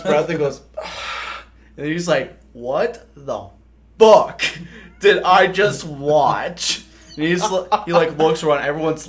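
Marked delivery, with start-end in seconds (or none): 2.5-6.8 shocked sergeant yell. Retold or imitated. First sergeant yelling at a recruit, slow shocked outburst.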